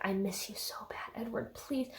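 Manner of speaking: whispered whimper rising to a high plea; hammed-up needy lovesick pleading; mock clingy pining. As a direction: whimper it, building in pitch and volume. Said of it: "they're very codependent on each other".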